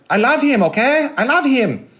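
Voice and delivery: put-on high, pleading gush of a lovestruck girlfriend. Girlfriend swooning over Dustin Hoffman, high pleading voice. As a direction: insist you love him, way up high. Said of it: "She's like".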